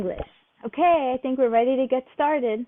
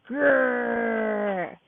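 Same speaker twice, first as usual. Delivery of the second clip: playful mock bear growl for kids. Shown as a demonstration. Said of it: "Simon says, "Growl like a bear".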